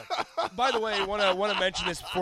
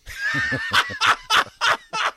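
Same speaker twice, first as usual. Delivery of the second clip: high, loud cackling laugh; loud burst of laughter; 10 dB louder than usual. Real, not acted. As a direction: explode into laughter.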